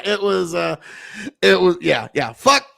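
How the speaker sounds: normal talking voice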